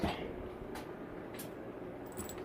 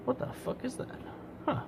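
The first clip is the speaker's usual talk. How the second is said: breathy, hushed surprise; startled whisper; 10 semitones higher, 15 dB quieter than usual. Real, not acted. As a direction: whisper it, caught off guard. Finds something odd in cable.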